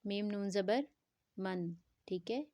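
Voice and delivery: normal talking voice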